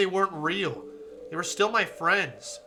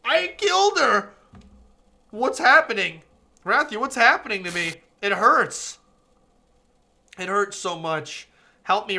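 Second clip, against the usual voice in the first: hurting voice, pleading for help; pained game girl; 3 semitones higher, 7 dB louder than usual. Acted. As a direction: voice her begging in pain. Voicing dying character in pain.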